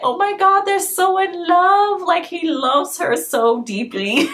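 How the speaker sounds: high, swooning and slow, lovestruck; gushing teen fangirl. Retold or imitated. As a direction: gush like a smitten teenager. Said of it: "at the time I was like".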